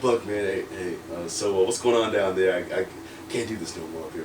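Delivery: low, soft, halting approach; sheepish plea. Retold or imitated. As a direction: ask hesitantly, almost under your breath. Replays own sheepish plea to trainer.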